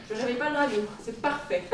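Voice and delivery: hushed so the dragon sleeps; whispering over a crib. Acted. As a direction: keep it soft and secret. Sneaking past sleeping dragon.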